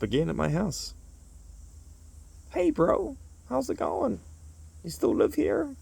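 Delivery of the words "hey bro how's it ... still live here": much higher, breezy bro greeting; chirpy high bro at the door; imitating Jam. Jam returns after a year, in a high bro voice.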